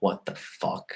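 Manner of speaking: hushed whisper. Retold or imitated. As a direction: breathe it in alarm. Sees sheriffs through keyhole.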